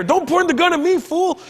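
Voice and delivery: high-pitched indignant friend's protest; Gabriel. Retold or imitated. Friend heard in the hallway facing a gun, high indignant voice. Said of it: "And it's Gabriel".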